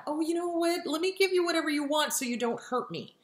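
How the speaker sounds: fawning placating. Retold or imitated. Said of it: "or fawn".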